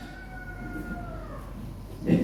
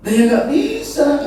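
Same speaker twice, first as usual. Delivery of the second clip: much higher, slow refusal; angel refusing; 14 semitones higher than usual. Retold or imitated. Angel refusing Idris's wish, high and slow. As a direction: drag out the no, high.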